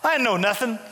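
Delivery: usual speaking voice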